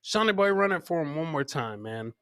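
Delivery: ordinary delivery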